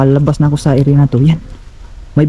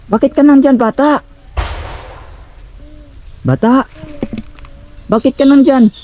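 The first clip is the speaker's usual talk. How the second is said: raised, questioning call; 12 semitones higher than usual. Real, not acted. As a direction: call out across a distance, voice raised high. Calls out to child in dark.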